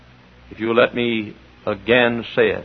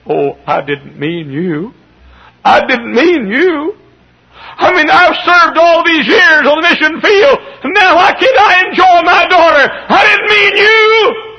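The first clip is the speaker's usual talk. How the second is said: building from slow objection to high, loud cry; protest, climbing into a wailing shout; acted anguished protest; 7 dB louder than usual. Missionary father to his volunteering daughter, acted rising anguished cry.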